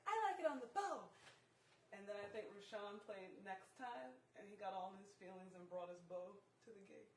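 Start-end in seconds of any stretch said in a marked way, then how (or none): 0.1-1.1 mock motherly exclamation, pitched up; imitating mother. Quoting mom's verdict on the bass player's bowing, mock exclaiming. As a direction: put on mom's higher, emphatic voice. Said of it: "Mommy's like".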